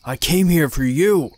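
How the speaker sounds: menacing high, loud yell; shouting. Acted. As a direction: roar the threat at him. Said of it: "he started shouting".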